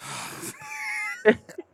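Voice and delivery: high-pitched mock gasp; comic drawn-out high gasp; imitated gasp. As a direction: mimic a shocked, wordless reaction. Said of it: "And he just goes".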